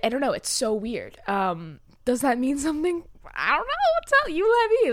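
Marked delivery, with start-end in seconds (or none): 3.4-4.9 high, loud eager plea; excited. Real, not acted. Asking listeners to explain.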